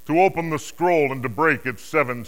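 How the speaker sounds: mighty herald's call; loud proclamation. Acted. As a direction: ring out the challenge, high and loud. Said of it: "proclaiming in a loud voice".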